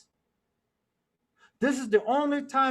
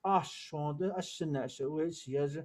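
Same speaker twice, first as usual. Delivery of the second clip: ordinary delivery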